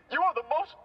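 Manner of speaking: overcome, barely getting the words out; choking up. Acted. Car thanking the man who saved it, breaking down in tears. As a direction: voice cracking into a breathy, tearful break. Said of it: "I promised myself I wouldn't get emotional".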